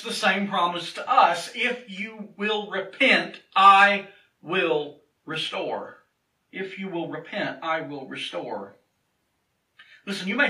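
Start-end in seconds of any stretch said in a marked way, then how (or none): 1.5-6.0 very slow, weighty declaration with gaps between words; slow emphatic promise. Acted. Preacher declares promise, then repeats it. 6.5-8.8 quieter, quicker repeat of a promise; softer restatement. Acted. Same promise repeated more softly.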